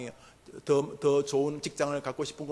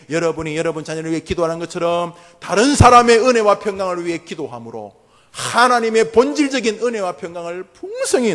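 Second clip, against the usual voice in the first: fervent blessing; 2 semitones higher, 11 dB louder than usual. Acted. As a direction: proclaim the blessing with full force. Preaching at full force. (힘찬 축복 설교)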